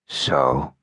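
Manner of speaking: かなり低く抑えた男の声; 喉の奥から低く沈んだ声で; low voice. A narrator's reading. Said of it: "said Low Voice".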